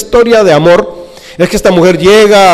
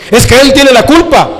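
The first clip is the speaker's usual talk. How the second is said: whiny excuse; 10 semitones higher, 3 dB louder than usual. Acted. Congregant excuses blaming spouse.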